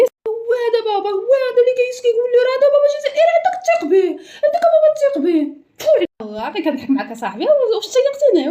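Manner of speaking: high sobbing whine; crying child. Acted. Crying child pointing out his father to the neighbour. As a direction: wail it like a hurt boy.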